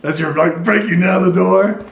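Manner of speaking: normal talking voice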